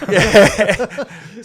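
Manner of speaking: high loud laugh through the yes. Real, not acted. Answering the hosts' joke about Crookwell, laughing.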